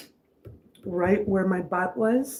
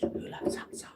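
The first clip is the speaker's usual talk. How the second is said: whisper; 12 dB quieter than usual. Real, not acted. Whispered question to viewers.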